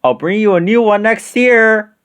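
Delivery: high, carrying farewell call. A narrator's reading. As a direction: call a promise back from the sleigh. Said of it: "Santa called".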